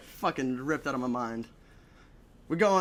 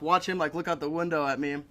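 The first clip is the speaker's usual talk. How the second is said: normal talking voice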